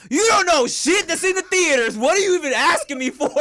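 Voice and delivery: imagined snap at a nosy mother; mock angry rant. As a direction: let the annoyance burst out, hammed up. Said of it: "fighting every urge to be like".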